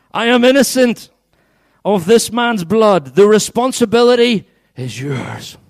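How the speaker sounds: high, loud, slow proclamation; declaiming Pilate. Acted. Pilate washes his hands before the crowd, loud proclamation. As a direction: shout it out as a public disclaimer.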